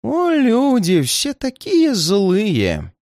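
put-on high, very slow old man's wail; plaintive, theatrical misery; wailing lament. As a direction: lament with long drawn-out vowels. Old man laments in distress.

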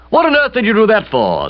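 high, loud aunt demanding an explanation; exasperated aunt. A narrator's reading. Aunt questions young man's engagement, exasperated. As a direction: pitch it up in indignant disbelief.

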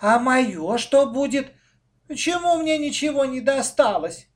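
put-on high, plaintive little-child whine. A narrator's reading. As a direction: complain pitifully, left out. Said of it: "Женечка жалобно спросила".

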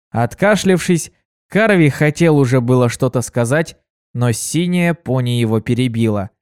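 ordinary delivery, nothing marked

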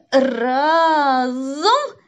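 громкая протяжная команда; тяни слово с натугой, громко; команда на усилие. A narrator's reading. Мальчики вместе переворачивают сундук. (протяжная команда с натугой)

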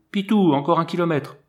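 a touch of calling out; speaking out loud. A narrator's reading. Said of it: "Je lui répondis bien haut".

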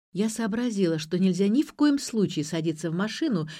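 usual speaking voice

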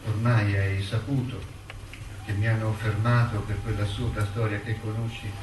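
measured, sombre letter read as a voiceover. Acted. Prisoner writing to his mother from his cell, sombre reading.

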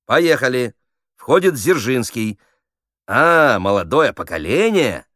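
director feeding a line. Acted. Director feeds actor the line.

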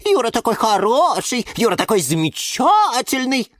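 high, gushing mimicry of a smitten girl; mocking chirpy imitation. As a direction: parrot her adoring chirps with jealous scorn. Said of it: "щебетала".

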